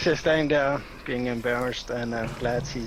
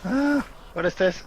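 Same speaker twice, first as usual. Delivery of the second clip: alarmed; 7 semitones higher, 4 dB louder than usual. Acted. Character discovers strange hair.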